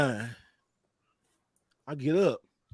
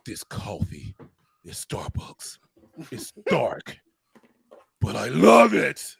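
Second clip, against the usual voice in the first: Kratos whisper; 6 semitones lower, slower than usual. Retold or imitated. Demanding coffee from his friend, in a low whispered warrior voice. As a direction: hush it down low like a menacing warrior. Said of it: "I did the Kratos".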